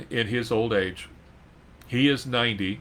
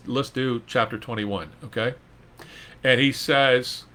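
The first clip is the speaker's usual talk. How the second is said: normal talking voice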